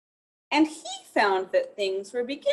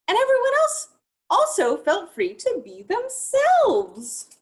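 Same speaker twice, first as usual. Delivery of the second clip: much higher and louder, slowing to finish; joyful; 12 semitones higher, 6 dB louder than usual. Acted. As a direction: land the happy ending grandly. Joyful story climax.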